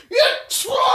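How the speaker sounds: huge shouted sneeze, dad style; big sneeze. Retold or imitated. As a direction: imitate dad's explosive sneeze. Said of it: "he'd go".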